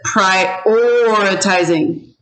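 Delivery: "prioritizing" slow, OR held very long; drawn-out O. Shown as a demonstration. Teacher asks first or second vowel, "prioritizing" with long-held OR. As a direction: stretch the O in "prioritizing". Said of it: "Long O".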